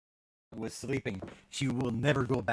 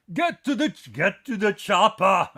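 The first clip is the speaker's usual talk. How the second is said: Arnold impression; 6 semitones higher, 14 dB louder than usual. Quoting the movie to chat viewers, loud hammed-up Arnold. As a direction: bark it like a mock action hero. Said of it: "I need to practice my Arnold voice".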